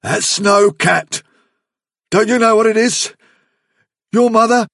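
loud, high, horrified insistence; mounting dread. A narrator's reading. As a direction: build toward panic, then break off. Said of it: "lips drawn back in a stiffened, unnatural grimace".

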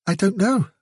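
high, small, unhappy voice; miserable small rabbit. A narrator's reading. Fiver answering the chief about the danger, miserable. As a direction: answer helplessly, pitched right up. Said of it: "he concluded miserably".

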